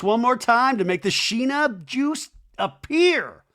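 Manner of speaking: high, slow showman's incantation; hammed-up magic call; playful summoning. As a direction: conjure her up with drawn-out, building drama.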